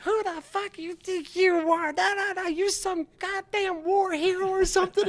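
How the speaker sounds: very high mock screech; hammed-up falsetto tirade, mimicking an enraged woman; ballistic woman sergeant. Staff sergeant tears into him, imitated ballistic rant. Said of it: "this woman goes ballistic".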